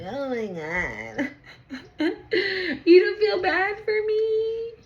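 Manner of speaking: high whiny fake crying; mock whining. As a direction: whimper like a fake victim. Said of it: "that's how they wanna come in".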